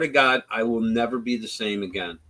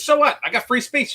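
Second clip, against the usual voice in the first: much higher, louder mock heckler whining back; defiant heckler; 12 semitones higher, 3 dB louder than usual. Heckler snaps back at Charlie, high mocking voice. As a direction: toss it off like a bratty heckler.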